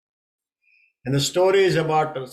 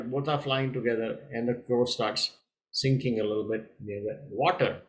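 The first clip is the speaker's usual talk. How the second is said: ordinary delivery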